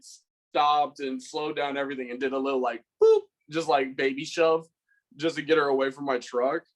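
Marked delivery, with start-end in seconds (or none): 3.0-3.2 squeaky high "boop", light and silly; playful boop. Retold or imitated. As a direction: make the shove sound harmless and cute. Mimicking a tiny gentle shove.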